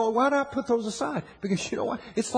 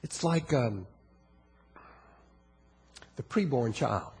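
quiet altar call; 6 semitones lower, 9 dB quieter than usual. Acted. Preacher softly appeals to congregation over music, hushed. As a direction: draw them in, low and intimate.

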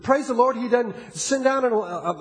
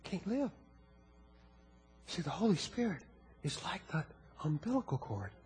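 quiet altar call; 6 semitones lower, 9 dB quieter than usual. Acted. Preacher softly appeals to congregation over music, hushed. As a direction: draw them in, low and intimate.

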